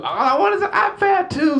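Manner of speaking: put-on high, pleading kid voice; whiny begging daughter. Daughter begging her mom for an iPad, high and whiny. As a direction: whine it like a kid.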